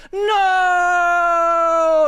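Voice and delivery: howled high, loud, overwrought no; mock Hollywood anguish. Man kneeling in the rain over a dead friend, hammed-up anguished howl. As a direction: ham up a movie hero's cry of grief. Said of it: "very Hollywood man kneels down into the rain and goes".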